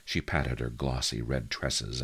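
ordinary delivery

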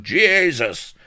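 loud, high yelp; crying out. A narrator's reading. Half-drowned man crying out, loud high yelp. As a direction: cry out in desperation. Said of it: "cries of".

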